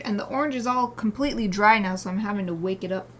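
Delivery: ordinary delivery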